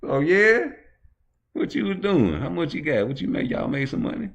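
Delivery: pry for how much he made; nosy eager questioner. Retold or imitated.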